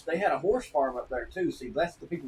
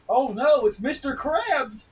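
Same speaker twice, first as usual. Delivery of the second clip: loud, high, hammed-up "oh no"; mock alarm; 11 semitones higher, 8 dB louder, slower than usual. Streamer pretending to panic at spotting Mister Krabs. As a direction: play it like a cartoon panic.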